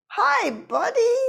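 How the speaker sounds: high, slow sweet hello to a puppy; tender greeting. Retold or imitated. Meeting the dog, gently saying hi to a new buddy.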